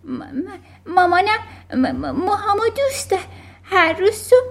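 put-on high, trembling child's stutter; terrified stammering. A narrator's reading. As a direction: stutter fearfully, catching on the first sounds. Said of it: "آماندا ترسون و لرزون، تته پته کنان گفت".

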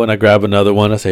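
ordinary talk, nothing marked

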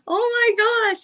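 high-pitched, drawn-out oh my gosh; high retold exclamation; retold gushing reaction. Acted reaction to friend's message.

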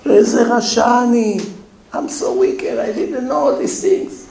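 high-pitched, mock-tearful quote; imitated tearful confession. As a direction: imitate a man crying with remorse. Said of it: "he started to cry, crying, crying, shaking".

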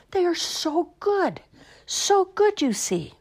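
high, slow relish; delighted savoring. Acted. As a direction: savor every word.

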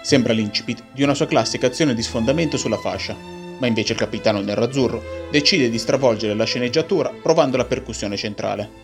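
ordinary talk

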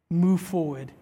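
usual speaking voice

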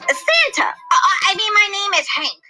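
high blurt, then stammered cover; high-pitched, flustered correction; flustered slip. Acted. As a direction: catch yourself and hastily correct. Santa blurts name, hastily covers.

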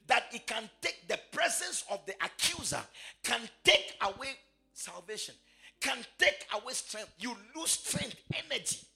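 high, ringing, half-sung preaching; preaching at full force. Acted. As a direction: preach it out to the congregation.

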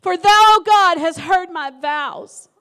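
loud, slow, ringing proclamation; fervent scripture. Acted. Pastor proclaiming the psalm to the church, loud and fervent. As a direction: cry out the verse to God.

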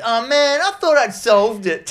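high, loud mock dismay; hammed-up disappointed hero; whiny dismay. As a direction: play the beaten hero, whining. Retelling film character's disappointment.

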